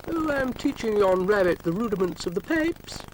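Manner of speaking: high, loud Scottish burr, hammed up; a Scotsman proudly explaining the pipes; loud hammed-up Scottish brogue; Scottish accent.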